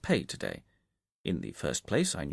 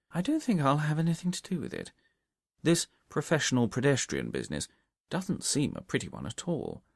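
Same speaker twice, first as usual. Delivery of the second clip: plain reading voice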